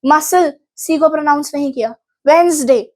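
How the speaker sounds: usual speaking voice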